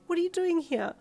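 high, loud surprised question; surprised squeak. A narrator's reading. Passenger asks cabbie why he is here, squeaking. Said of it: "you squeaked with surprise".

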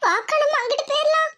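high-pitched, dismayed exclamation; alarmed. Acted. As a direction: urge your companion away in alarm. Alarm as food is knocked away. (பதறிப்போய் உயர்ந்த குரலில்)

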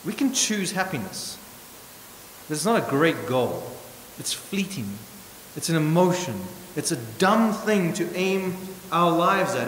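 ordinary talk

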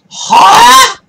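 a high, loud, disbelieving huh?; outraged huh. Real, not acted. Stuck in the game, a high outraged shout. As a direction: shout at the frozen character.

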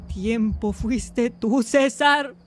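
drawn-out, hammed-up realization; mock heartbroken discovery; dramatic betrayal. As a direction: stretch it slowly like a soap-opera revelation.

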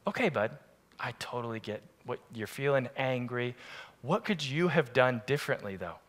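soft, gentle dad voice; father calmly coaching his son; calm fatherly tone. Retold or imitated. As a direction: reassure the boy softly.

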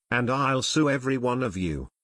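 ordinary delivery

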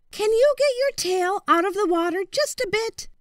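put-on high, courteous young voice; boy's high voice. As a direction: politely turn the crocodile down, voiced high.

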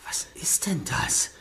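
hushed, slow and wary; slow suspicious whisper. Acted. Spots a strange box, wary.

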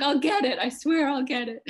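loud, high retold plea through laughter; laughing promise. Actor promises the laughing cast, giggly mock-desperate insistence. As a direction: insist helplessly while cracking up, re-enacting it.

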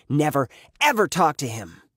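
angry, forceful order; furious. A narrator's reading. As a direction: forbid it angrily, each word hit hard. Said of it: "got furious".

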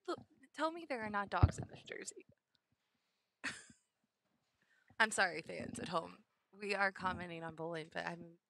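ordinary talk, nothing marked

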